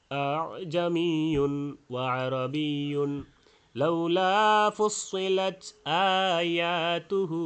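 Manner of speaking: slow, measured tilawah; slow, measured Quran recitation. Acted. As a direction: recite the verse slowly and melodically.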